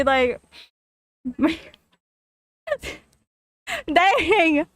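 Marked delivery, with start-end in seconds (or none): none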